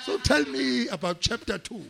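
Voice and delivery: high, loud, over-personal coaxing; Jacob Zuma. Retold or imitated. Politician wanting the report explained in detail, chummy imitation. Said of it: "he becomes very personal with you".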